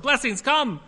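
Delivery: high, loud joyful shout; triumphant chorus. Retold or imitated. Preacher ready to join the beach woman's chorus, high loud joy. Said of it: "readied myself to join in with her triumphant chorus".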